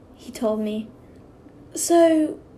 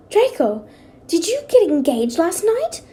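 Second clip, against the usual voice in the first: high, loud excited question; 5 semitones higher, 6 dB louder than usual. Acted. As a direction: burst out in delighted surprise. Coworker gasps at engagement.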